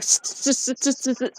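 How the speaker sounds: soft hissing repeated s; imitated stutter. Retelling video of man with stuttering problem, mimicked stutter. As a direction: get stuck rhythmically on the first sound. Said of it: "he got stuck on a part of it".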